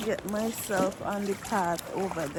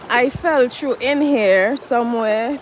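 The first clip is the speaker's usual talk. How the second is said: exasperated; 10 dB louder than usual. Real, not acted. Frustrated after losing clicker.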